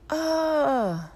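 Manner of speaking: high drawn-out mock scream, falling away. A narrator's reading. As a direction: cry out loud and long as if hurt on a mountain. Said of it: "and screams".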